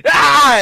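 sudden high loud "Ah!" burst; mock climax yell. Retelling his climax to podcast friends, a loud mock yell. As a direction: blurt it out like the moment hit.

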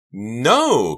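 high, loud scream of denial. A narrator's reading. Karina rejects the accusation, screaming. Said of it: "Karina screamed".

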